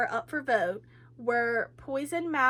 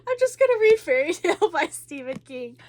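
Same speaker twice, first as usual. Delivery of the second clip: louder, laughing through the words; 7 semitones higher, 10 dB louder, faster than usual. Real, not acted. Deciding to read another book, laughing. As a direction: giggle it out, relieved.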